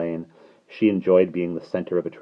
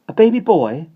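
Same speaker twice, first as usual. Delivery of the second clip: high, loud, rising question; eager small-boy query; asking child; 12 semitones higher, 10 dB louder than usual. A narrator's reading. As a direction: pipe up like a curious boy. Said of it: "asked Michael".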